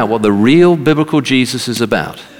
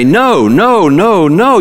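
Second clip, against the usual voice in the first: "know" hammered over and over, high and loud; emphatic repetition; 9 semitones higher, 6 dB louder, slower than usual. Real, not acted. Preacher stressing the verse's key word, "know" loudly repeated. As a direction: hit each "know" harder, pitch swooping up.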